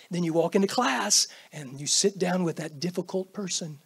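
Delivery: normal talking voice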